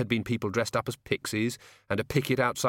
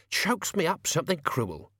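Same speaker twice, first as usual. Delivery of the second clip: lightly voiced grumbling servant, pitched up; mildly hammed-up moan about soot; pointed complaint; 7 semitones higher than usual. A narrator's reading. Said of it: "said Albert loudly".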